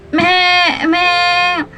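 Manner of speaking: playful bleating meh meh; goat bleat. Shown as a demonstration. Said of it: "Can you say it again?".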